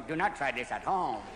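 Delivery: low, soft Indian yogi voice; calm yogi warning. Retold or imitated. Indian yogi at home warning viewers, low and calm.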